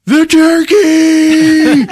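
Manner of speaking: long, high, loud wail; horrified mock scream. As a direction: scream it drawn out in horror. Dad realizes turkey is in danger.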